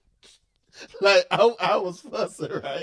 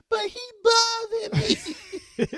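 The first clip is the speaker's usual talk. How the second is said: higher mimicked child whining; whiny little boy; 6 dB louder than usual. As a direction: whine it like a little kid.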